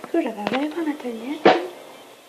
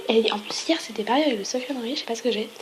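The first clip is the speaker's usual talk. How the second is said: ordinary talk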